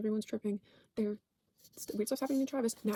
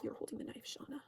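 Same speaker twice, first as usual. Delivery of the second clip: soft creepy quote; 11 dB quieter than usual. Retold or imitated. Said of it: "she goes".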